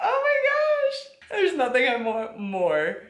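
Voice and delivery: high, slow and gushing; excited squeal. Real, not acted. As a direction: squeal it out with delight. Gushing over the book club.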